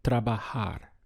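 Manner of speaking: ordinary talk, nothing marked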